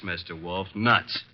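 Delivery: usual speaking voice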